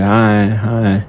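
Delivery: ordinary delivery, nothing marked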